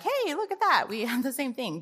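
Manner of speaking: high, happy exclamation of finding a match; delighted discovery. Retold or imitated. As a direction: sound thrilled to see it. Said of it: "it's wonderful when you match and you're like".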